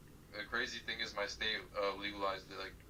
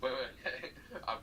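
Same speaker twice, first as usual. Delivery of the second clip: after laughing hard; 2 semitones higher, much slower than usual. Real, not acted. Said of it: "I need to catch my breath".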